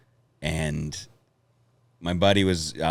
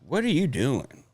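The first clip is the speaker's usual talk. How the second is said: lightly voiced homeless man, higher and drawn out; slow, higher-pitched mock puzzled question; homeless guy; 8 semitones higher than usual. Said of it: "homeless guy that was like".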